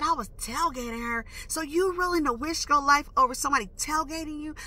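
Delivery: high, incredulous challenge; retold street-talk comeback. Driver confronting the girl who jumped out, retold indignant street talk. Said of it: "I'm talking my little street talk".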